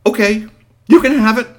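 high, loud, hurried surrender; the boy quickly handing it over; hasty giving in. Acted. Storyteller voicing the boy giving in to Bigfoot, high and loud. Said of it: "And then he remembered".

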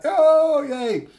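high-pitched delighted yay; squeaky cheer. Acted. Toy robot cheering at being found, high squeal.

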